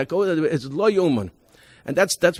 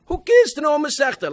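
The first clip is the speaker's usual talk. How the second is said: much higher, louder jeering challenge; mock loudmouth yell; 12 semitones higher, 4 dB louder than usual. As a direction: toss it out like a cocky kid heckling the teacher. Mocking loudmouth student's outburst.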